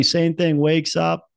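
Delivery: normal talking voice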